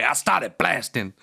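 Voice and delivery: slow, put-on movie-character delivery; Danny DeVito. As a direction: imitate a famous actor's drawn-out line. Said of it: "that was fucking Danny DeVito".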